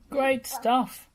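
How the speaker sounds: high, loud praise; shouted. A narrator's reading. Bob shouting praise to Muck, high and loud. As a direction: shout it with delight. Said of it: "shouted Bob".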